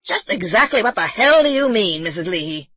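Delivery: furious shout at the housekeeper. Acted. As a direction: lose your temper on the word hell. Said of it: "He was shouting".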